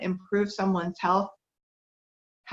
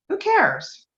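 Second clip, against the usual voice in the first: much higher and louder, flinging the question out; exclaiming outburst; 12 semitones higher, 6 dB louder than usual. Real, not acted. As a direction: toss it away with a loud, high burst. Rhetorical outburst in a talk.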